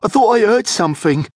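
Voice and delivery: high, quick, jumpy voice; jittery accomplice character; nervous young thief. A narrator's reading. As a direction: blurt it out uneasily, pitched high. Nervous young thief heard a noise.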